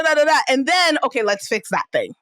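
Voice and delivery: ordinary talk, nothing marked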